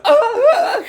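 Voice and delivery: a sudden squeak breaking the voice; voice crack. Real, not acted. Ranting about his channel when his voice cracks upward. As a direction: voice suddenly cracks high and loud. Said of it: "fucking voice crack".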